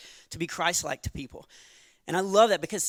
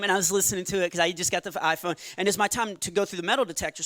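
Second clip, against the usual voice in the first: ordinary talk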